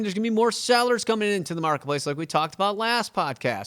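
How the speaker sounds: normal talking voice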